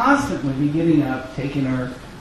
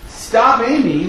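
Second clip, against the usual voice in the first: mild retold yell, higher; yelled stop; 8 semitones higher, 5 dB louder, slower than usual. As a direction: call it out to a running child. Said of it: "when we yelled".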